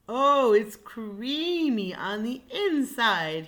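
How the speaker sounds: slow and loud, relishing; savoring slowly. Acted. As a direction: linger over each word. Lion savoring.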